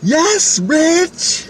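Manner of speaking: very high, hammed-up answer; overacted drama queen. Drama-class coworker answering her cue, overacted drama queen. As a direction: sing it out like a bad actress. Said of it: "too much acting there".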